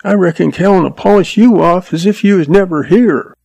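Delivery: put-on high-pitched cheeky kid; boy cutting in. A narrator's reading. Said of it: "the boy Jack cut in".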